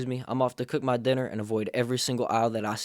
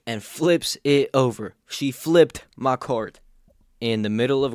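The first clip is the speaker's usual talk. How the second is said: louder and slow, hammering each word; outraged emphasis; 6 dB louder, slower than usual. Real, not acted. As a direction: pound out the outrage slowly. Outraged retelling of cart flip.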